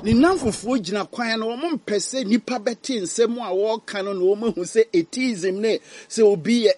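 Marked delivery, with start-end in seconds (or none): none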